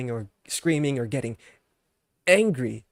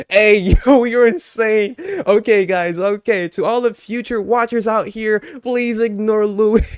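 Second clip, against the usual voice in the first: excited outburst; 6 semitones higher, 8 dB louder than usual. Real, not acted. Laughing outburst at streamer's remark.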